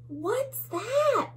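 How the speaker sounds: high startled little bug voice; tiny bug. Acted. Another tiny bug asking, high and startled. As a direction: ask it like a scared tiny bug. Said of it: "says another".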